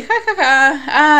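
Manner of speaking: slow, high mock laughter; a laid-back laugh, done as an imitation; imitated laugh. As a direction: play his easy chuckle. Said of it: "riéndose".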